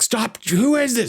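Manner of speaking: high-pitched panicked demand; mock freaking out. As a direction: act out a man losing it on the phone. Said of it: "He's freaking out".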